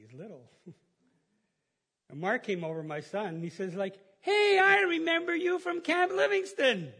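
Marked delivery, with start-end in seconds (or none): 4.2-6.9 high, loud happy recognition; excited greeting. Retold or imitated. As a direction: call out like meeting an old friend. Son greets neighbour he recognises.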